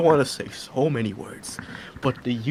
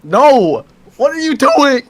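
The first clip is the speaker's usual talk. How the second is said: high loud outcry; outraged loud cry; loud protest; 11 semitones higher, 10 dB louder, slower than usual. Real, not acted. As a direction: yell it in alarm. Protest at being shot.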